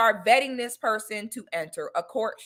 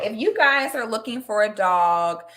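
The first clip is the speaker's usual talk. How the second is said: ordinary talk, nothing marked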